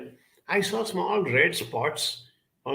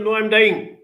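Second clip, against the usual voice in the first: put-on high, loud child's wail; 8 semitones higher, 8 dB louder than usual. To his father, a small boy wailing loudly. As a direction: insist through tears that you're dying.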